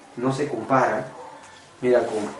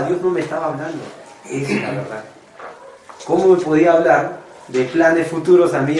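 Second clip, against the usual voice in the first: ordinary talk, nothing marked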